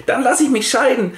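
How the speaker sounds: a wife threatens her husband in a huff; mock shrill threat. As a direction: threaten divorce in a hammed-up huff. Mother's outraged threat.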